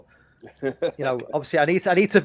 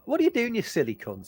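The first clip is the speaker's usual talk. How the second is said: higher, breezy teasing tone; light jokey insult; 8 semitones higher than usual. Shown as a demonstration. To mates, showing the casual way, breezy teasing. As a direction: toss it off like friendly banter. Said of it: "But if you just go".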